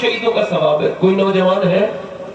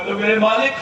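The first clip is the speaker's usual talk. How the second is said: preaching at full force; 9 semitones higher than usual. Acted. Preacher thunders the tale to the gathering, fiery. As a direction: drive the story home with fire.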